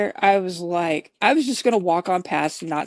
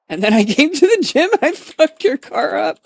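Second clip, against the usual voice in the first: high, loud words through real laughter; laughing; 9 semitones higher, 7 dB louder than usual. Real, not acted. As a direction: confess while cracking up. Confessing, cracking up.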